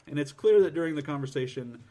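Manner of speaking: ordinary talk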